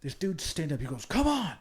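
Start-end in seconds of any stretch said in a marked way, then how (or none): 1.1-1.5 a sudden high shouted "come on"; mock hype yell. Dude hyping himself before charging, high yell. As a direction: pump yourself up with a yell.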